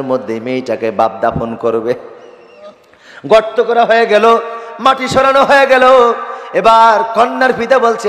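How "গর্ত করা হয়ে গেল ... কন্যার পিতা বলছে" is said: high, ringing sermon delivery; impassioned preaching. Acted. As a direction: lift the story into a high preacher's chant. (আবেগভরা উঁচু গলায় ওয়াজ)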